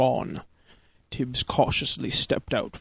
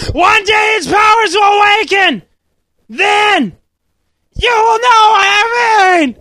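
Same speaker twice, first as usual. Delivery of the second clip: high, strained full-volume yell; wounded father; 14 dB louder than usual. A narrator's reading. Dying father's prophecy.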